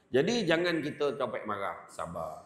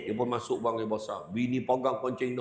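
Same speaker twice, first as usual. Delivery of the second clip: ordinary talk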